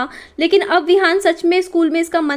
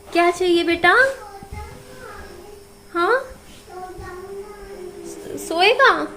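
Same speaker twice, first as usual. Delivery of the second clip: higher, coaxing questions to a sleepy son; 5 semitones higher, 5 dB quieter than usual. Real, not acted. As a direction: gently coax the little one back to sleep. जागे बेटे से पूछती माँ. (जागे बेटे विहान से माँ, ऊँची कोमल आवाज़ में)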